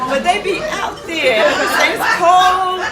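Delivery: excited. Real, not acted. Marvelling at early runners.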